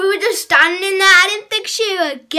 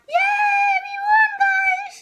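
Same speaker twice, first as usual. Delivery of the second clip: excited cheer; slower than usual. Real, not acted. Team wins the game.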